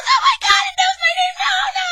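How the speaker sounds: very high, loud panicked shriek; mock terrified girl screaming in the wagon. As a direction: scream as if the monster knows you. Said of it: "And she goes".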